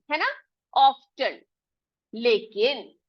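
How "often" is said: often as loud "off-ten", t sounded; spelling pronunciation. Shown as a demonstration. Teacher repeating the reading by spelling, spelling pronunciation. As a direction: punch out the t in often. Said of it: "spelling के हिसाब से".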